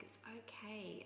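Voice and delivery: whispered reassurance; whispering to comfort the upset lady; soft whisper. Retold or imitated. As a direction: breathe it gently to calm her. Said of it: "I was like".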